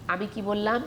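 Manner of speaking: normal talking voice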